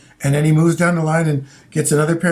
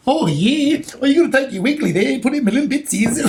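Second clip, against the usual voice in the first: mock Australian accent; 6 semitones higher than usual. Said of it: "that Australian vernacular".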